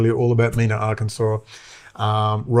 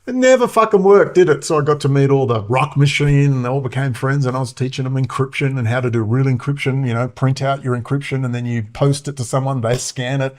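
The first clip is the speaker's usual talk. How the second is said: ordinary delivery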